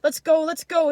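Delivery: high, loud insistent urging; urgent father. A narrator's reading. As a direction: hurry the child away. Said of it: "his father says to him".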